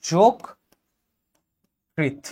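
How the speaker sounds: "যোগকৃত" as jok, long pause, krit; slow syllable split. Shown as a demonstration. Teacher dictating to students, "যোগকৃত" split jok - krit. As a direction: draw out "যোগকৃত" in two separated parts. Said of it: "তুমি লিখবো হচ্ছে".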